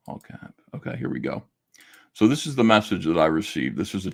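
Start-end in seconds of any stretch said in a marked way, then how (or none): 0.1-1.4 hushed to himself. Real, not acted. Reading to himself, pulling up message.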